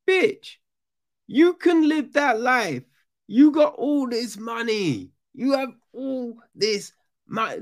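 very high-pitched incredulous squeal; squeaky, exasperated telling-off; exasperated retort. Retold or imitated. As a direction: scold in a comic falsetto. Exasperated retort to whining character.

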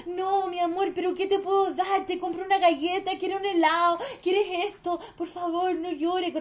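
high, coaxing mock mother's voice; pleading Chilean mom. Chilean mother begging her screaming child in public, high coaxing mock plea. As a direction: beg the crying child like a doting mom. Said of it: "las mamás".

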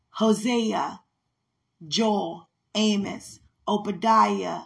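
measured recital of the books; name each prophet slowly with a fall; careful rehearsal recital; recitation. Acted.

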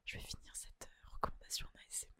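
soft breathy whisper; ASMR whisper. Pretend. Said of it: "On va partir sur de l'A S M R".

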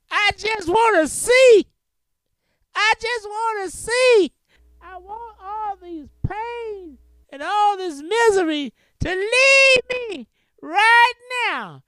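high, loud pleading cry. Acted. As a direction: beg to see, voice straining upward. Blind man begs to see.

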